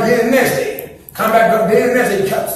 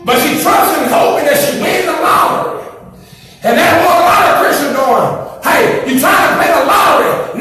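preacher roaring to the church; full-force preaching; 6 semitones higher than usual. Acted. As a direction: hammer it home to the congregation. Preaching at raised pitch.

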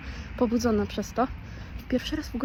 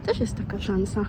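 ordinary talk, nothing marked

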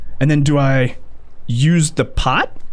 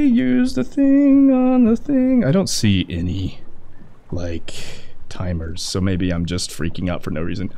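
usual speaking voice

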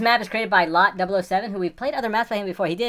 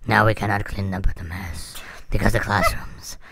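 low, slow creepy voice; 10 semitones lower, 3 dB louder than usual. Shown as a demonstration. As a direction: drag it out low and eerie. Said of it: "she have this creepy voice like this".

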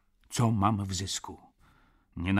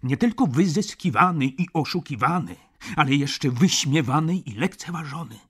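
rising, louder and higher, pressing harder; more and more boldly; 6 semitones higher, 7 dB louder than usual. Acted. Tipsy friend pressing Stach about being mocked, ever bolder and louder. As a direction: push the point home, bolder with each phrase. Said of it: "mówiłem coraz śmielej".

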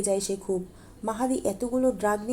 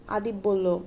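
usual speaking voice

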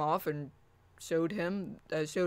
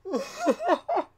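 high burst of laughter; 7 dB louder than usual. Real, not acted. Reacting to robot voice.

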